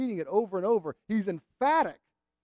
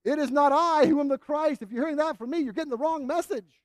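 louder and higher, firmly refusing; John the Baptist firmly denying he is the Messiah; vigorous denial; 6 semitones higher, 5 dB louder than usual. Acted. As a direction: push the claim away hard. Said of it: "He vigorously repudiated any suggestion".

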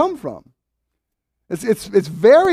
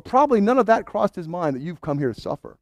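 ordinary delivery

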